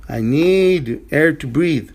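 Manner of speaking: need leaned on and drawn out, whole line slow; slow stress on need. Shown as a demonstration. Teacher stresses need to show must-have. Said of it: "teacher stresses need".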